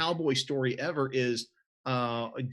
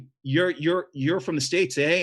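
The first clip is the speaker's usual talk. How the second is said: mock Canadian accent, higher and louder; 4 semitones higher, 5 dB louder than usual. Cowgirl asks if he's from the States, Canadian accent. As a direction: imitate a Canadian cowgirl, lifted pitch. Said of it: "have Canadian accents".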